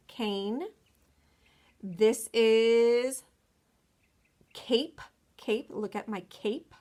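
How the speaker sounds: put-on slow, clear word-by-word reading for children; name each picture slowly and clearly; slow picture naming. Shown as a demonstration. Teacher naming picture words slowly.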